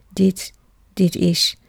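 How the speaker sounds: halting, broken start; lightly voiced stammer. A narrator's reading. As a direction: stumble over the words, caught off guard. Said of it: "stamelt Ed".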